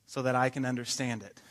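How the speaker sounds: ordinary talk, nothing marked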